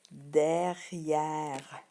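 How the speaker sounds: "derrière" said again slowly and clearly, final E silent; repeated model word. Shown as a demonstration. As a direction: model it for the class, ending on the R. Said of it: "Je répète".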